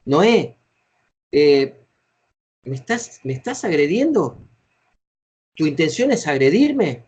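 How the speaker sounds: hesitant pauses, then "agrediendo" and "agredirme" in a questioning tune. Shown as a demonstration. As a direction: pause after "Noé", then ask "¿me estás agrediendo?" as a question. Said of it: "formularlo en tono de pregunta".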